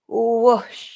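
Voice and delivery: loud whoosh with a rushing sh; breathy whoosh. Shown as a demonstration. Yoga teacher showing a big out-breath, whooshing loudly. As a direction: push the word out on the breath. Imitates rush of exhaled breath.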